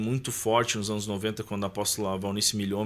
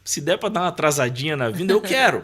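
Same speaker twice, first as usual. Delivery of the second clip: pitched-up bargaining with God, half joking; cheeky child's prayer; 5 semitones higher, 5 dB louder than usual. Retold or imitated. Boy asking Jesus to hold off his return, playfully bargaining. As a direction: plead playfully for a little delay. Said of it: "eu orava ao Senhor dizendo".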